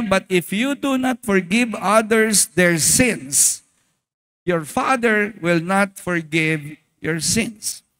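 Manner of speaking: slow, measured Bible reading; solemn reading of Matthew six fifteen; scripture reading. Acted. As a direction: read the verse slowly and solemnly. Reads Jesus' words from scripture.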